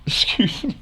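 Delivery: breathless through real laughter; real laughter, voice almost gone; laughing. Real, not acted. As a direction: gasp it out mid-laugh, nearly choking. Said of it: "I'm laughing".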